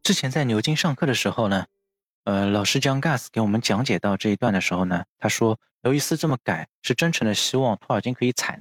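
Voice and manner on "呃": normal talking voice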